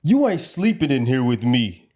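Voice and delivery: emphatic, drawn-out banishment; mock scolding. Acting out banishing him to the couch.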